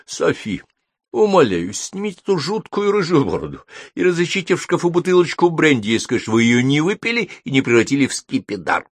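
high-pitched, dramatic begging; pleading. A narrator's reading. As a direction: implore with weary exasperation. Exhausted wizard pleads for help. (умоляюще, высоким голосом)

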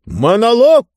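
high, loud, ringing cry; Mister Speaker shouting. A narrator's reading. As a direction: shout it out as a grand announcement. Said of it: "прокричал мистер Спикер".

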